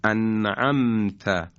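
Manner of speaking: انعمت said very slowly with a nasal hum; ghunna demo. Shown as a demonstration. Tajwid teacher repeating the example slowly. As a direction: stretch انعمت through the nose. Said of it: "نون و میم ساکن در فضای بینی هستند".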